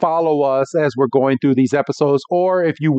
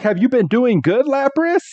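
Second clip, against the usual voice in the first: put-on higher, eager boyish voice; eager Ash; 10 semitones higher than usual. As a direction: ask it like an excited boy. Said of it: "He's like".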